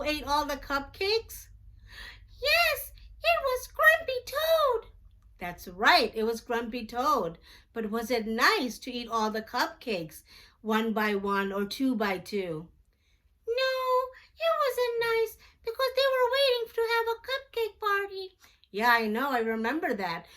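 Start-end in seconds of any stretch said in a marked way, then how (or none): 2.4-4.9 very high squeaky puppet voice, quick; a tiny excited puppet friend; high cartoon puppet chatter. Puppet Jackson answers. 13.5-18.4 very high squeaky puppet voice, quick; a tiny excited puppet friend; high cartoon puppet chatter. Puppet Jackson answers.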